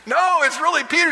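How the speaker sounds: high, insistent protest; mock-insistent servant girl; insisting Rhoda.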